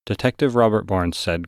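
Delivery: plain reading voice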